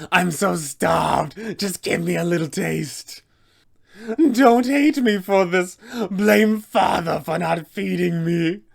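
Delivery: ravenous vampire voice. Acted. Vampire spawn strikes a lone adventurer, hungry pleading monster voice. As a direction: beg and menace at once, starving. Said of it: "screaming out".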